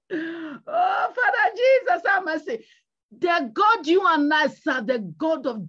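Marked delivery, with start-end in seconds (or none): 0.1-2.6 high, wailing mock plea; hammed-up cry to heaven; crying out for mercy. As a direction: plead with raised pitch, as if tearful. Acts out crying out to Jesus.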